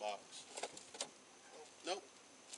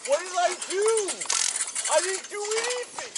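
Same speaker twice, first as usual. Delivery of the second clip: high, loud, slow desperate shouting; frantic yelling; 9 semitones higher, 10 dB louder than usual. Real, not acted. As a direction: wail it out in panic. Screaming while being arrested.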